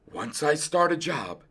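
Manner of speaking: understated resolve, softly spoken; firmly. A narrator's reading. Dentist to his worried wife, firm. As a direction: state your rule with quiet firmness. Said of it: "said the dentist firmly".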